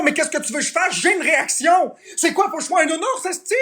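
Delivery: furious exasperation at the trolls; exasperated outburst. Real, not acted. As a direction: snap back at the chat, pitch climbing. Streamer furious at chat harassment.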